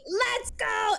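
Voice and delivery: high, eager rallying yell; mock battle cry. Imitating Eren charging into the fight, high and eager. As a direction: fire up the troops. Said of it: "[name]'s like".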